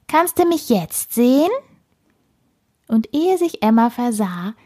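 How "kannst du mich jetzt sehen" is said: high, teasing squirrel question; smirking. A narrator's reading. Squirrel asks the girl if it is visible, smirking. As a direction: squeak it with cheeky glee. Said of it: "feixte es ganz nah an ihrem Ohr".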